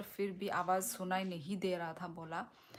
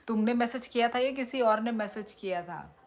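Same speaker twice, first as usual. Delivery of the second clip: laughing tease; 4 semitones higher, 6 dB louder than usual. Real, not acted. Teasing viewer about the message.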